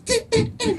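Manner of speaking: high-pitched little mock sneeze; imitated small, squeaky sneezes; tiny dainty sneeze. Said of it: "when he's around the one he loves".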